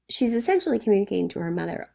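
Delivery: ordinary delivery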